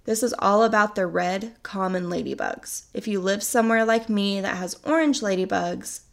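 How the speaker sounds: ordinary talk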